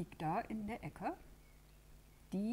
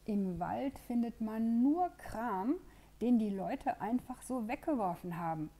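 complaining; 5 semitones higher, 7 dB louder than usual. Acted. Lela complains to the animals about forest junk, moaning. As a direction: whine it like a fed-up bird. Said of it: "beklagt sich Lela".

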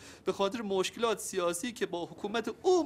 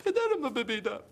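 breaking into sobs, much higher; crying; 8 semitones higher than usual. Acted. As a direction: let the voice crack into tears. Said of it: "تو رو جان سیمین گریه نکن". (گریه با صدای شکسته و زیر)

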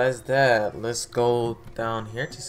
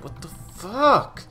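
high, drawn-out cry of disbelief; frustrated outburst; 10 semitones higher, 6 dB louder, slower than usual. Real, not acted. Gamer stuck in the game, frustrated outburst. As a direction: stretch it out and shoot upward, real exasperation.

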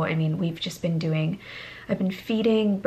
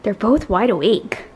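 ordinary talk, nothing marked

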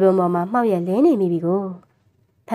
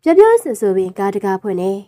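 usual speaking voice

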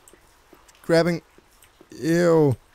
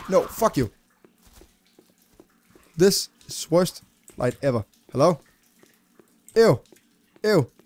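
usual speaking voice

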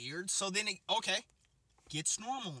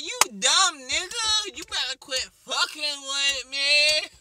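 high, loud, slow taunt; girl play-fighting; 9 semitones higher, 11 dB louder, slower than usual. Retold or imitated. Girl play-fighting with the heavy guy, high loud taunt. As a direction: taunt him playfully, high. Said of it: "She's like".

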